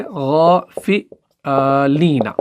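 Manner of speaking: गौफिलीना with a thick throaty ghain, drawn out very slowly; heavy ghain. Shown as a demonstration. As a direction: stretch गौफिलीना from the upper throat. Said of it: "गॉइन को हम मोटा पढ़ते हैं".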